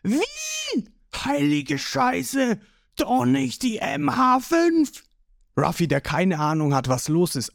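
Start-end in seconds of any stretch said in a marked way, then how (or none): 0.0-5.0 high, drawn-out cry of alarm; panicked high-pitched crowd; shocked yelling. A narrator's reading. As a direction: shriek in shock at the weapon. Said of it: "sind schockiert und schreien los".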